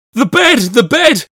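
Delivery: very high, loud, panicked exclamation; frantic repeated scream; terrified cry. A narrator's reading. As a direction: shriek it out in terror. Terrified narrator points at bed.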